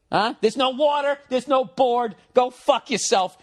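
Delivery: high-pitched, scornful comeback; defiant retort. Acted. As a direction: dismiss his threat with rising scorn. Defiant retort to imagined interrogation.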